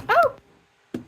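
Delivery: very high, sudden "oh"; startled yelp. Real, not acted. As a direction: yelp as if caught off guard.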